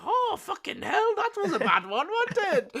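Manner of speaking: high, put-on Jamaican exclamation; Jamaican dub. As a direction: shaken cartoon fireman in a mock Jamaican accent. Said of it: "like, Jamaican dubs of".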